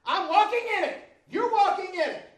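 loud, high, fervent preaching; shouting the sermon up to a climax; full-force preaching. Real, not acted. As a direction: preach it out with rising fire.

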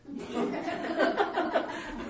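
airy wheezing laugh; real giggling fit; breathless high laugh. Real, not acted. Laughing at his own outburst.

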